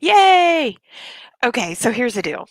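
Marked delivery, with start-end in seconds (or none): 0.0-0.8 high, loud happy yay; excited cheer. Real, not acted. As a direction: cheer it out with relief. Celebrating that audio now works.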